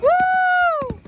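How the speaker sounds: high, loud "woo"; high cheering whoop; excited whoop. Real, not acted. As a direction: whoop it out in celebration. Cheering at fireworks show.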